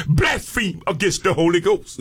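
usual speaking voice

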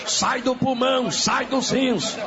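forceful, shouted exorcism commands; short commanding bursts, driving the sickness out; deliverance prayer. Real, not acted. Preacher ordering sickness out of the sick, forceful deliverance prayer.

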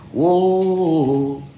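a drawn-out showman's whoa into the mic; singer's call. Retold or imitated. Lead singer [name] calling out to the audience, a drawn-out showy whoa. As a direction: call out to the crowd like a stage singer.

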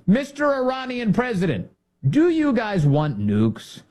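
high-pitched, exaggerated address; teasing formal question; mock questioning. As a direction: pose it like a hammed-up interviewer, drawing out the end. Mock interview question to the president.

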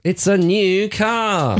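slow, high game show host reveal. Pretend. Co-host joking about a new car, game show host voice. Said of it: "Price is Right".

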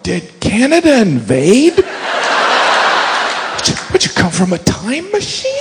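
staring amazed at a cop on a horse; baffled wonder. Retold or imitated. Baffled at a mounted policeman.

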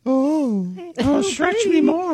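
high, drawn-out mock plea; affected voice. Teasing co-host about the body stunt, high affected voice. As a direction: put on a high, slow, hammed-up voice. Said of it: "Did you affect a voice?".

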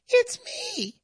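high squeaky little man voice; mock little Uncle Sam squeak; tiny little voice. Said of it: "And he says".

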